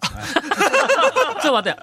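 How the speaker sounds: laughing. Real, not acted. Laughing surprise at caller.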